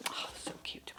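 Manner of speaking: a tender whispered coo. Real, not acted. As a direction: breathe it out in awe. Cooing over the figure.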